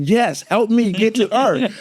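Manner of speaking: high, drawn-out desperate plea; acted high-pitched desperation; mock-frantic plea. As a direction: plead for help, hammed up. Frantic husband begs for help.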